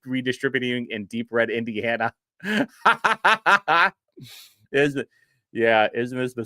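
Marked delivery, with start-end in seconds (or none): 2.4-3.9 a hearty real laugh. Real, not acted. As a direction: burst of real laughter, louder. Laughing at clip.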